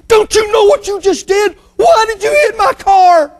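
high furious yell; angry shout. Acted. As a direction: scream at the kid over the car. Furious executive grabs kid.

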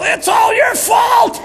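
high, drawn-out woman's shriek; accusing yell. Retold or imitated. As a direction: yell the blame at full volume. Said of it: "I heard one woman yell".

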